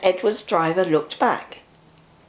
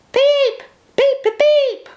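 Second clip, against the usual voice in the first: engine whistle; 5 dB louder, slower than usual. A narrator's reading.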